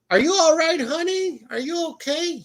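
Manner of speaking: high-pitched imitation of an anxious grandmother; worried grandma. Grandma checks on grandson after scam call, high worried imitation. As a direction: fuss over him in a high grandma voice.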